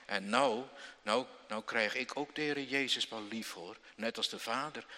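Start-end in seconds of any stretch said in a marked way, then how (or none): none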